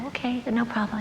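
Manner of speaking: soft, hushed agreement; acted furtive whisper; whispered reply. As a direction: whisper back discreetly. Whispered answer in class.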